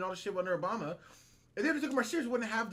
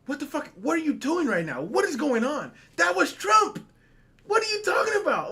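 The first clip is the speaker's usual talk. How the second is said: loud, high outraged questioning; raised voice, incredulous outburst; exploding disbelief; 7 semitones higher, 7 dB louder than usual. Retold or imitated. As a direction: yell it in exasperated disbelief. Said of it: "it made my mind explode".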